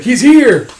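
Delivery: high, loud burst of excitement; excited shout. Acted. Warrior hearing the door rattle, excited shout. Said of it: "he's like".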